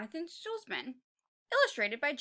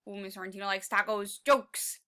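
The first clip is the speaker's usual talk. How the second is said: ordinary delivery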